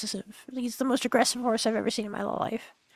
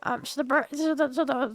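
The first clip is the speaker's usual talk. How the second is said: usual speaking voice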